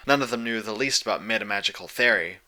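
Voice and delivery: plain reading voice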